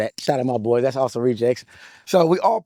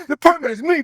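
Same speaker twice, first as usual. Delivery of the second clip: higher and louder, topping the first; rising swagger call; bigger cocky boast; 11 semitones higher, 4 dB louder than usual. Retold or imitated. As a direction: crank the boast up a notch. Repeated boast, bigger second take.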